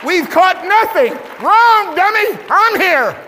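loud, high scolding retort; mock retort. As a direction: snap back at them, teasing. Jesus retorts to disciples.

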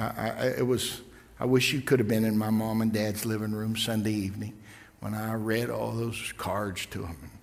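ordinary talk

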